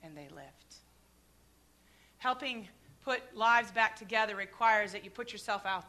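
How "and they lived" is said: very soft, barely voiced; hushed. Real, not acted. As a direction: let it fall to a hush. Hushed echo of the dream.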